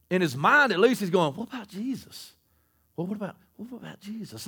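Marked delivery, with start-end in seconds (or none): 1.3-4.5 very soft, tentative questioning; hushed inner thought. Retold or imitated. Preacher voicing the apostle's private question, hushed. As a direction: voice John's thought under your breath.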